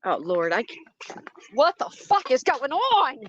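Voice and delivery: frightened, slow outburst at a noise behind her; spooked, rising to a startled cry; real fright. Real, not acted. Streamer hears an animal crying behind her, scared. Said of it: "I cannot deal with the wild today".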